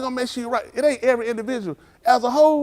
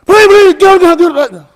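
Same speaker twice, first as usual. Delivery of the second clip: high, loud desperate begging; panicked cry of please get him; 1 frantic pleading; 11 semitones higher, 16 dB louder than usual. Retold or imitated. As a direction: beg frantically for help. Pleading in retold police chase.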